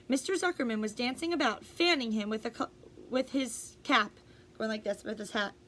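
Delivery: ordinary delivery, nothing marked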